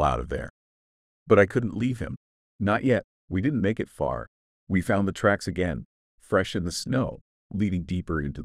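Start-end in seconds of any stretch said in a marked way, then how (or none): none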